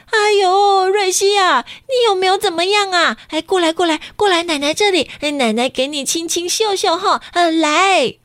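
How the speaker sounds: high, fussing grandma calling the chick close; anxious grandma. Acted. Grandma fussing over the bumped chick, anxious and high. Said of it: "奶奶很紧张地说".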